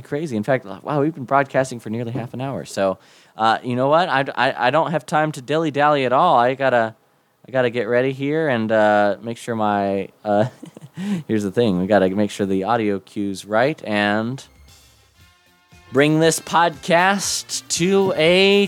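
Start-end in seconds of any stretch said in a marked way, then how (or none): none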